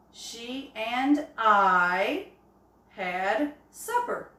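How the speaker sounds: each word read slowly and clearly; slow example sentence. Shown as a demonstration. Teacher reading an example sentence to her class, slow and clear. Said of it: "For example".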